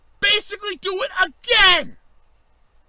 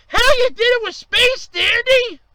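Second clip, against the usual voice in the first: louder, higher, voice cracking toward crying; near tears; 6 semitones higher, 5 dB louder than usual. Real, not acted. Fan pleading with the network, cracking toward tears. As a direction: let the hurt break through. Emotional rant.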